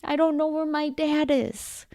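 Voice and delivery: a hint of a frightened little kid asking for help; slow, high, small voice of a lost child. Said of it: "they're like".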